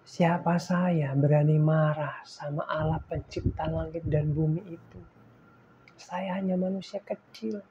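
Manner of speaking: a hushed, humble aside to God; soft murmur. Retold or imitated. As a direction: murmur it as if to yourself. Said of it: "saya lirih berkata gini".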